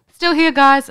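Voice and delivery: high, bright singsong call. Retold or imitated. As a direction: call out cheerfully. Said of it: "I'm like".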